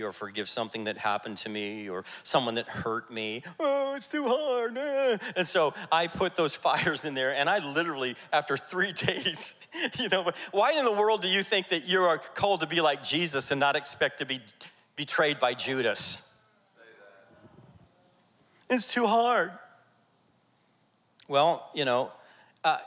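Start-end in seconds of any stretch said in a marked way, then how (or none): 3.6-5.2 high, drawn-out whiny complaint; put-on high-pitched moan of self-pity; mock whining. Mocking whiner in wilderness story. 18.7-19.5 high, put-on whiny voice; hammed-up high-pitched grumble; mock whining. Mocks those who complain.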